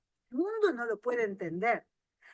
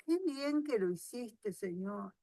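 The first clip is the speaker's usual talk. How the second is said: quiet aside to God; 8 dB quieter than usual. Retold or imitated. Preacher voices a private prayer.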